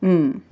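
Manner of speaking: "mm" hummed as one held sound; letter sound M. Shown as a demonstration. Teacher showing pupils the beginning sound, "mm" hummed. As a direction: hold "mm" with closed lips. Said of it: "Sound of".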